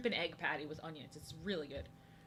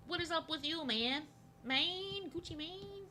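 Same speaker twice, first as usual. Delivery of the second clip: silly high voice; 9 semitones higher, slower than usual. Pretend. Silly voice greeting chatter.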